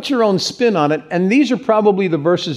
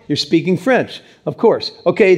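ordinary talk, nothing marked

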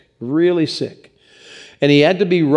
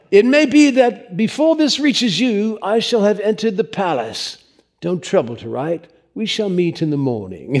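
bad English accent; 6 semitones higher than usual. Pastor reading a dying writer's letter, in bad English accent. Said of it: "read it in a really bad English accent".

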